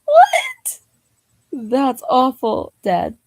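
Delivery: very high, loud outcry then a slow groaning complaint; shocked cry. Real, not acted. Scolding the dad in the joke, shocked cry. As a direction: squeal in disbelief at the joke.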